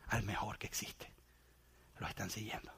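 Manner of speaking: awed whisper, barely voiced. Real, not acted. Preacher whispering to the congregation in awe. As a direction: breathe it out in wonder.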